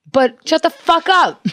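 high, drawn-out mock-angry retort; mock outburst. Host joking about escalating a fight, mock outburst. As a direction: burst out in a high put-on voice.

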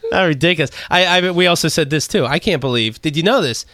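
ordinary delivery

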